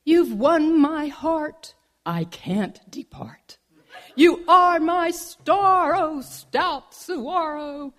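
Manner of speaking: slow, hammed-up love verse; mock romantic poetry reading; recited love poem. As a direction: declaim it like a smitten bad poet. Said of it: "recite some more poetry".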